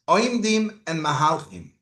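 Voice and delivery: ordinary talk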